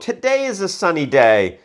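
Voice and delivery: exciting sunshine voice. Shown as a demonstration. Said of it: "That's my exciting sunshine voice".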